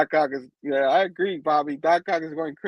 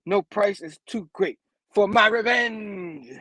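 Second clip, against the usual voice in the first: high, slow, theatrical vow; hammed-up supervillain declaration; ranting villain; 5 semitones higher, slower than usual. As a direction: stretch the last word out grandly.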